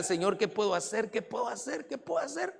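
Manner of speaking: soft, climbing, hurried mock weeping; tearful pleading. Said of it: "él empezó a llorar".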